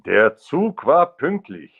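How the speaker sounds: high, slow, loudish German; careful German accent. Shown as a demonstration. Retrying a book title in German, emphatic German accent. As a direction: deliver the German line emphatically, drawn out.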